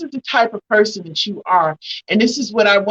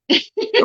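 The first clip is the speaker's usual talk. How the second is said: laughing; 13 semitones higher than usual. Real, not acted. Laugh to hold off tears.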